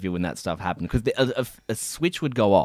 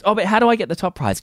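high, quick mock customer; needy contestant impression, rattled off high; pestering customer; 9 semitones higher, 3 dB louder, faster than usual. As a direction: imitate a pestering punter, pitched up and hurried. Same customer pesters about top prize.

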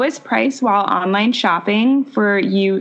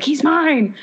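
claiming a guy; 7 semitones higher than usual. Pretend. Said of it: "I'd be like".

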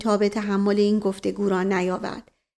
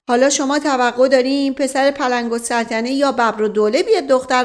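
firm serious voice; 5 semitones higher, 5 dB louder than usual. Acted. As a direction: lay it out sternly, no joking. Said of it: "با قیافهٔ خیلی جدی و صدای محکمی".

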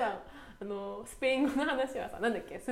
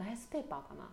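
whispering; 2 semitones lower, 8 dB quieter than usual. Real, not acted. Both drop to whisper.

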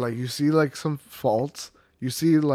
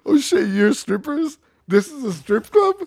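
mock astonishment; 11 semitones higher, 4 dB louder than usual. Friend mimics accidentally finding a strip club, mock amazement. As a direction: play dumb with a hammed-up discovery.

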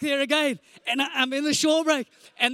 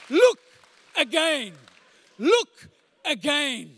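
drawn-out, ringing repetition; slow emphatic; 2 semitones higher, 5 dB louder, much slower than usual. Acted. Preacher repeats the title slowly to the congregation. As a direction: stretch the words out. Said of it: "repeated title".

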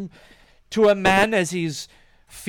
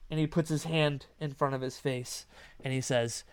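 ordinary talk, nothing marked

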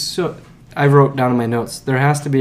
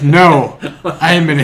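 louder, higher indignant no; indignant denial; 3 semitones higher, 8 dB louder, slower than usual. Retold or imitated. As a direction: protest it, a touch huffy. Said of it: "And he's like".

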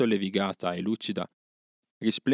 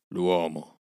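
growled. A narrator's reading. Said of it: "brontolò Akela".

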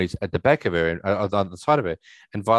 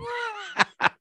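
high burst of laughter; sheepish laugh; 4 dB quieter than usual. Real, not acted. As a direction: laugh at being caught. Caught out, laughs it off.

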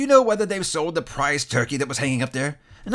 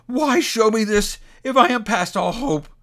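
high, loud, urgent pleading; desperate cry; 11 semitones higher, 5 dB louder than usual. A narrator's reading. Scrooge clutching the spirit's robe, desperate plea. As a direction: beg to be heard. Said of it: "he cried, tight clutching his robe".

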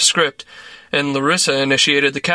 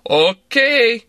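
smiling; 7 semitones higher, 3 dB louder than usual. A narrator's reading. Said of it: "he said, smiling".

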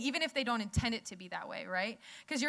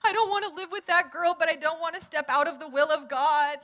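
high, loud, hammed-up weepy whine; imitating a roommate sobbing over a dilemma; laughing mock cry, higher and louder; 6 semitones higher, 7 dB louder than usual. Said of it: "she's, like, crying, sobbing".

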